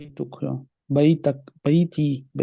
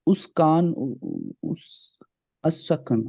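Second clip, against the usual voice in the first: ordinary talk, nothing marked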